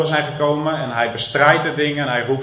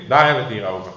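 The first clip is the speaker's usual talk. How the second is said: ordinary delivery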